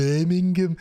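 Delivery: "Birmingham" in a mocking Brummie accent, lightly done; teasing imitation of a Birmingham accent. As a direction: say "Birmingham" the Brummie way, poking fun. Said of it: "the way that they say it".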